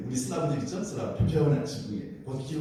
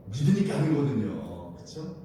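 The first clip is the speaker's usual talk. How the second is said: ordinary delivery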